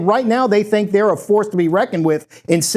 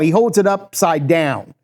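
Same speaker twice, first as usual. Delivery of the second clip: ordinary delivery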